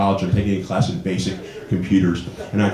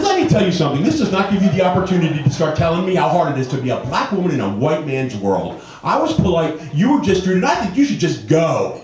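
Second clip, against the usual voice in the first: high, forceful retold rant; indignant telling-off; 8 semitones higher than usual. Shopper telling off the rude woman, indignant. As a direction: lecture her with mock outrage.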